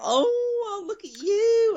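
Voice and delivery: high gushing auntie voice; cooing relative. Retold or imitated. Doting relative cooing at a child, high and gushing. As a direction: coo like a doting aunt pinching cheeks.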